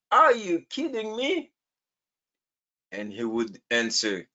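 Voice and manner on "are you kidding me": incredulous. Acted. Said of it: "I would say".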